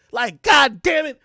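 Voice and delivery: mock-exasperated yell; frustrated shout. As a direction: bellow it in frustration. Frustrated standing awkward.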